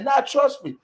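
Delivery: high, brushing it off; mock cocky friend. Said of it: "He like".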